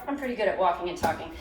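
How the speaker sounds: ordinary talk, nothing marked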